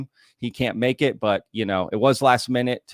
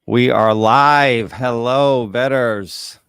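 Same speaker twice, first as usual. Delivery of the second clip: big, slow hype greeting, much louder; loud stream opener; 9 dB louder, much slower than usual. Real, not acted. Host kicking off his live stream, loud and drawn out. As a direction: call out to the whole chat like an announcer. Opening a livestream to viewers.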